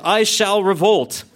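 high, slow declaration; drawn-out, mock-heroic vow; determined. A narrator's reading. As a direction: proclaim it with hammed-up resolve.

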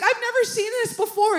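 high, panicky voice; hammed-up high-pitched disbelief; retold alarmed exterminator. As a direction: imitate a rattled exterminator. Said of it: "And he's like".

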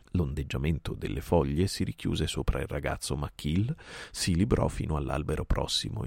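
ordinary delivery, nothing marked

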